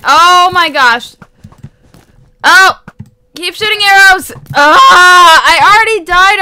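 loud, frantic gamer panic; sudden loud alarm at dying; panicked yelling. Real, not acted. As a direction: yell it as everything explodes. Panicking as arrows explode.

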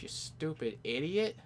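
ordinary talk, nothing marked

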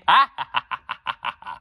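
gloating laugh at the siblings; bratty mocking laugh. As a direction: cackle at your own insult. Joker laughs at insult.

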